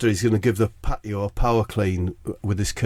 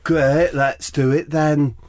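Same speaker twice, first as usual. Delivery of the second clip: slipped impression, pitched up; 5 semitones higher, slower than usual. Comedian as [name] accepting, accidentally in a higher footballer voice. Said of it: "I've got a bit [name]".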